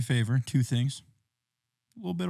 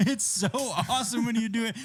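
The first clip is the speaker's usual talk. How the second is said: high, laughing through the words; can't stop laughing; 10 semitones higher than usual. Real, not acted. Laughing at friend's accent.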